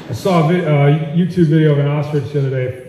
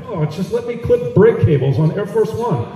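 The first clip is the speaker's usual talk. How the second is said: higher, hammed-up sly plea; mock pleading Melania; 5 semitones higher than usual. Melania begging to cut brake cables, higher whine. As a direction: beg like a scheming villainess. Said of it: "Melania pleading".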